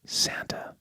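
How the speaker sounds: whispered with quiet wonder; hushed reveal. Retold or imitated. Angel revealing who can help, in a whisper. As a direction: breathe it out as a secret.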